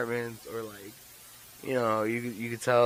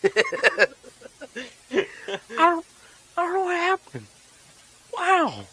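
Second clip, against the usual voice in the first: laughing; 9 dB louder than usual. Real, not acted. Cracking up after impression.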